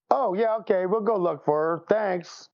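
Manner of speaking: ordinary delivery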